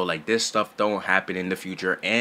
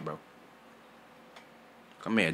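ordinary talk

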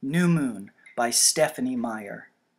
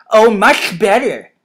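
loud, slow sarcastic retort; snorted scorn; 7 semitones higher, 15 dB louder, slower than usual. A narrator's reading. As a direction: draw it out scornfully, pitched up. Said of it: "he snorted".

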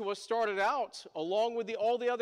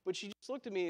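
ordinary talk, nothing marked

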